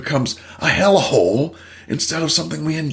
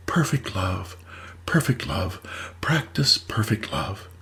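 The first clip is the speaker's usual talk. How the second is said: lightly voiced solemn guru, soft and slow; guru's message; 5 semitones lower, 6 dB quieter, slower than usual. Guru teaching the snake, a hint of hushed solemnity. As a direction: intone it quietly like a wise teacher.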